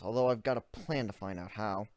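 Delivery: ordinary delivery, nothing marked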